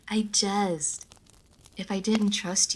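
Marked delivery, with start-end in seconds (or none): 0.0-1.0 laughing jest. Acted. Vampire teasing listener after threat.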